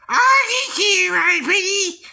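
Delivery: very high, loud, slow witch voice; Wicked Witch. Retold or imitated. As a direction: screech it like the Wicked Witch of the West. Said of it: "the witch from the Wizard of Oz".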